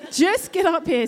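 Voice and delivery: loud, high-pitched demand; urgent, fed-up summons, acted out; exasperated order. Exasperated call to maintenance, retold.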